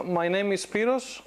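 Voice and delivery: usual speaking voice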